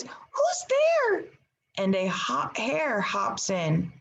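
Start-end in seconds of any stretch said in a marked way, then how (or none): 0.0-1.2 very high tiny mouse voice; squeaky mouse. Acted. Mouse calling out into the dark den. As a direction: squeak it out like a scared little mouse. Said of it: "Mouse cries".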